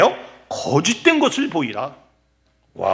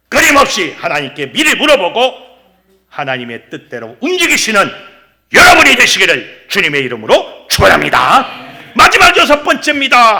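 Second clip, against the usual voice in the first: preaching; 2 semitones higher, 8 dB louder than usual. Real, not acted. Preacher urging people to ask God, forceful preaching. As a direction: drive it home to the congregation.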